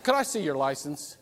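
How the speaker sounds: understated, controlled authority; polite cop. Acted. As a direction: calmly ask as a policeman would. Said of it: "He comes up and he says".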